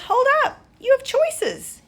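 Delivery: loud, very high interruption; calling a halt. A narrator's reading. Big Benny stopping the arguing ant triplets, loud high call. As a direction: call out to stop a squabble. Beetle breaks up an argument.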